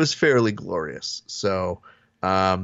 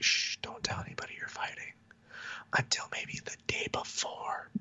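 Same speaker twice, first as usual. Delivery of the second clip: hushed secret whisper; 15 dB quieter than usual. Pretend. Host mimics a secret fight announcement, whispering.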